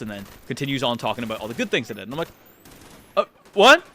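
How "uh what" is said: high, loud, startled question; acted double take, pitched up; stunned disbelief. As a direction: blurt it out in shock. Acted disbelief at hearing pass.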